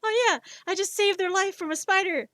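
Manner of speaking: loud, high mock bragging; acted boast. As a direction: crow about a heroic rescue, playfully. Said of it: "I'll be like".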